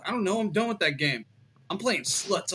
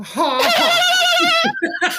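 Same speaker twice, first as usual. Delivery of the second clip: loud, high villainous cackle; evil cackle; 8 dB louder than usual. Acted. Reading the Ripper letter to friends, with a loud evil cackle. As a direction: burst out in a wicked shouted laugh.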